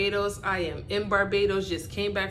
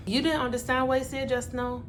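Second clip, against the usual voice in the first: put-on Bajan accent; 2 semitones higher, faster than usual. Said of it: "frustration of constantly having to repeat myself".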